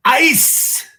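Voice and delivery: booming ballpark beer call, drawn out; stadium vendor hawking. Shown as a demonstration. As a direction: bellow it across the stands, long and slow. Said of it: "Give it to us, [name]".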